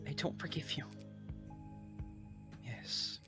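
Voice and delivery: ordinary talk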